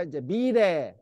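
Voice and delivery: usual speaking voice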